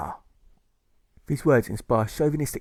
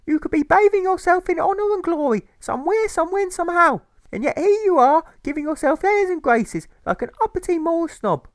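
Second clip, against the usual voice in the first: much higher, loud indignant tirade; outraged mother; 7 dB louder than usual. Acted. Mother condemning Alfred's refusal, much higher and loud. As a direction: rail at him in shrill outrage.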